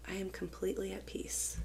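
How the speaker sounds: very soft, whispered calm; hushed serenity. Retold or imitated. Student to teacher during the fire drill, whispered and serene. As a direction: breathe it out peacefully, barely voiced. Calmed child's answer, retold.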